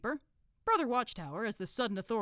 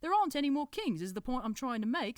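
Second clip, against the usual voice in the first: helpfully pointing it out in a higher put-on accent; 7 semitones higher, 5 dB louder than usual. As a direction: chip in eagerly, in character. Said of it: "said Brother Watchtower helpfully".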